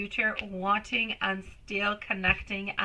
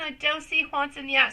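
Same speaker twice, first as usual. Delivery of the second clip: ordinary talk, nothing marked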